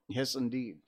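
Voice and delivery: ordinary delivery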